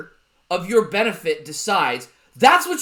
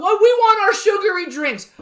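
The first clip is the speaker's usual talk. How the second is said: mocking whiny adult; 11 semitones higher, 6 dB louder than usual. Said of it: "whenever the fuck".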